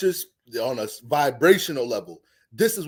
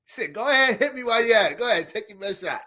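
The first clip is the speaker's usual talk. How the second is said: taunting dare; 6 semitones higher, faster than usual. Retold or imitated. Woman goading angry man.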